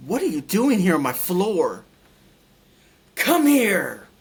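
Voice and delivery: loud, high-pitched scolding shout; caretaker's shouted order. Retold or imitated. Big caretaker finds a patient on her floor, shouted scolding. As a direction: imitate a caretaker yelling an intruder over, building to a big holler. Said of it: "Just like that".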